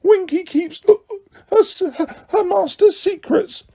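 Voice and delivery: a tipsy elf digging in her heels; Winky defiantly guarding secrets from Harry; mutinous drunk elf. A narrator's reading. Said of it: "she said mutinously".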